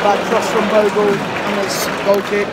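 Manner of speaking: loud, urgent match commentary; live play-by-play. Real, not acted. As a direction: call the action as it happens.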